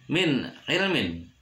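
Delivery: "min ilmin" with a short clear n; "min ilmin", no nasal hum; clear idhar reading. Shown as a demonstration. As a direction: cut "min" cleanly before "ilmin". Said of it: "tidak boleh dibaca".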